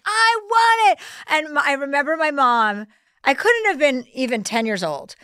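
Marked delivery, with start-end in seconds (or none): 0.0-1.0 high, drawn-out tantrum demand; child's tantrum. Retold or imitated. Retelling her childhood tantrum at the window, bratty high whine. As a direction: whine it out slowly like a kid throwing a fit. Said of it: "Bang my head against the fucking glass window and be like".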